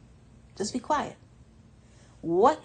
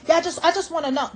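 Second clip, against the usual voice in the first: nagging mimic; 7 semitones higher, 6 dB louder, faster than usual. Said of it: "They can ask you a hundred times".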